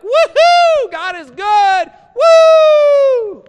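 high, loud whooping praise; mock ecstatic cheer. Churchgoer whooping for joy after a good service. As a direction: cheer like you just left church on fire. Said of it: "You're like".

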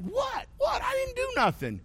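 put-on high, whiny protest of a teenager; teen protesting. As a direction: protest your innocence way up high.

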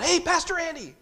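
mock a little boy's holler in a high, held pitch; imitated boy yelling. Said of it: "he yells out".

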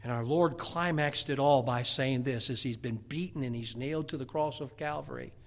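low, softened pulpit voice; hushed, solemn preaching. Acted. Preacher telling of Jesus nailed to the cross, solemn. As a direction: bring the congregation down to a hush.

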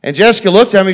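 ordinary delivery

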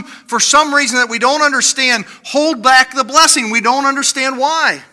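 usual speaking voice

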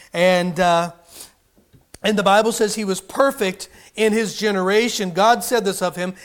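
ordinary talk

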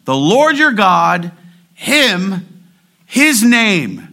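"Lord your God", "him", "his name" each high and loud; emphatic first column. Shown as a demonstration. Preacher shows which column is emphasized, punching "Lord your God", "him", "his name". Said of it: "what is the emphasis by the first column?".

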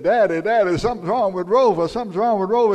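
put-on alarmed young son. Son runs in to tell Daddy about the dog, alarmed. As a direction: voice a worried boy calling his dad. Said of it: "his son came in and said".

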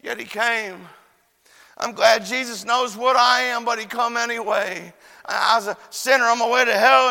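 emphatic evangelistic testimony; impassioned preaching. Real, not acted. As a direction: proclaim it with rising fervor to the congregation. Preaches at full force.

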